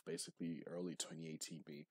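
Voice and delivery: ordinary delivery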